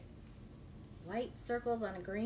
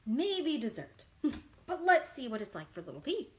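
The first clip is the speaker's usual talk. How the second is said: usual speaking voice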